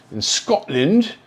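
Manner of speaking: slow, hammed-up Scottish accent; jokey bad Scottish accent. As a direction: put on a clumsy Scottish accent for the word. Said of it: "that was a bad accent right there".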